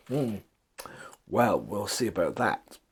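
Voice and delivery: soft chuckle, low and quiet; God chuckling to himself at the builders. A narrator's reading. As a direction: chuckle privately, knowingly. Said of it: "God chuckled to himself".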